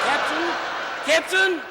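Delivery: calling out. Acted. Calling over captain's screaming.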